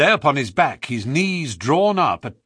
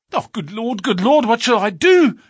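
high, loud cry of despair; 8 semitones higher, 5 dB louder than usual. A narrator's reading. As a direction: wail it in helpless panic. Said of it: "in an ecstasy of despair".

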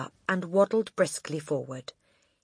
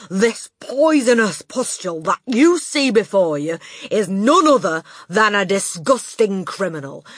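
furious ranting; 5 semitones higher, 11 dB louder than usual. Acted. Headmistress denouncing the boy to the school. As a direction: spit out every insult.

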